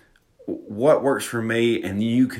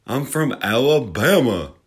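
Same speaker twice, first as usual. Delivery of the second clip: slow, hammed-up Alabama drawl; thick mock-Southern drawl, drawn out; exaggerated southern drawl; 3 semitones higher, 4 dB louder, slower than usual. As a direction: lay the Southern accent on thick, stretching Alabama. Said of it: "I have this southern drawl".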